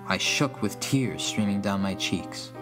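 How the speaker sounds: ordinary delivery, nothing marked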